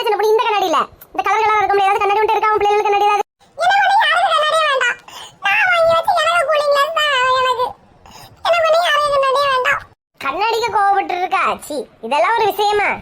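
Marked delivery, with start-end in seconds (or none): 3.6-5.0 high, whiny fake sob, with ஒண்ணு stressed; hammed-up tearful whine with a laugh in it; mock crying whine. Sulking over lost sunglasses. 5.4-7.7 mock crying whine. Sulking over lost sunglasses. 8.5-9.8 high, whiny fake sob, with எனக்கு stressed; hammed-up tearful whine, slightly calmer; mock crying whine. Sulking over lost sunglasses.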